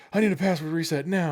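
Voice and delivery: high, loud imitated demand; huffy professor. Professors coming in to help desk, huffy demand. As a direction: act out an indignant, demanding customer. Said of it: "all huffy and like".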